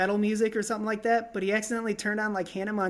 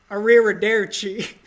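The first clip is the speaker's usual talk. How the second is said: loud, badly faked Italian; mock attempt at passing as Italian; 8 dB louder than usual. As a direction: blurt Italian with a clumsy accent. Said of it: "Just act like you're Italian".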